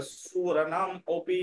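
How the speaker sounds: সুরনাম অপি drawn out with an "o" opening অপি; pronunciation model. Shown as a demonstration. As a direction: join সুরনাম to অপি and hold the final vowel. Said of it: "অ উচ্চারণ করে".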